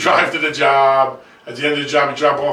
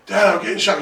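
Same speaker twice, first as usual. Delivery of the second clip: higher-pitched mock complaint; exasperated son, re-enacted; retold pained protest; 7 semitones higher than usual. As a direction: protest to dad, playing up the distress. Son protesting in acted quarrel.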